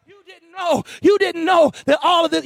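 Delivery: high, driving sermon shout; full-force preaching. Real, not acted. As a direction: preach it hard, drawing out the key words.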